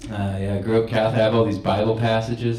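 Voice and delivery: usual speaking voice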